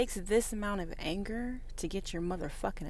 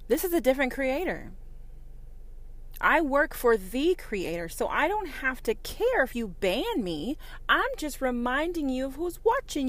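loud, higher defiant proclamation; fired-up rant; 4 semitones higher, 6 dB louder than usual. Real, not acted. Creator defying the platform that bans her, loud and fired up. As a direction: declare it louder and louder.

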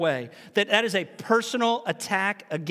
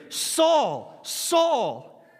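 drawn-out, emphatic calling of the name; slightly raised, intense voice from heaven; intense divine summons; 3 semitones higher, much slower than usual. Acted. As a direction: call him out slowly, like a parent's warning. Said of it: "it was meant to invoke intensity".

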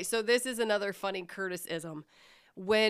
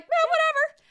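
high, loud breezy shrug; carefree; 11 dB louder than usual. Retold or imitated. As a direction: toss it off without a care. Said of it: "they're just like".

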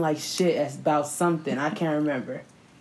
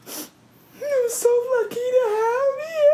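high, slow mock-crying wail; retold happy sobbing; 3 dB louder, slower than usual. Grabbed his face, retold tearful wail. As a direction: draw it out in an imitated tearful squeal. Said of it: "I literally started crying so".

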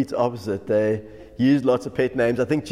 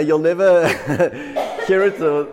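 loud, words broken by laughter; can't stop giggling mid-line; laughing; 6 dB louder than usual. Real, not acted. Pastor laughing at the pet name to listeners.

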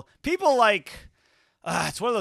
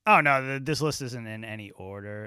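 ordinary talk

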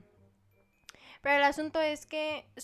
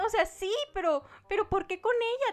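agudo y más fuerte, desesperado; reclamo lloroso; 8 semitones higher, 5 dB louder than usual. Retold or imitated. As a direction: ruega entre lágrimas que no sea ella. Reclama llorando en el sueño. (reclamo agudo y dolido)

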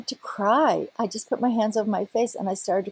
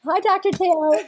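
laughing greeting; 8 semitones higher than usual. Pretend. Jokingly greets her neurologist.

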